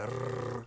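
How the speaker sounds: "r" as a long tongue-tip trill; rolled R. Shown as a demonstration. Teacher showing how to get the sound, long rolled R. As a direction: roll the tongue through "r", sustained. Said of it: "roll your tongue so you get a r sound".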